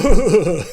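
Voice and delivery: a quick mocking chuckle at himself; mocking laugh. Laugh after mocking quote.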